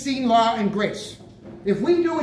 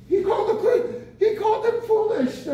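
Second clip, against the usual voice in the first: laughing; 9 semitones higher than usual. Real, not acted. Laughing while telling of Paul.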